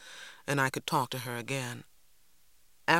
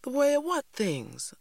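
put-on high, questioning child's voice; wary; 12 semitones higher than usual. A narrator's reading. Cassie questions Mama's explanation, wary. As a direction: ask it cautiously, pitched up like a child. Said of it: "I asked warily".

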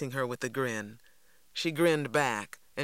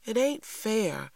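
put-on high, drawn-out childish complaint; sympathizing child; 8 semitones higher, slower than usual. A narrator's reading. Younger brother comforts frustrated sister, sympathizing. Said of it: "Christopher John sympathized".

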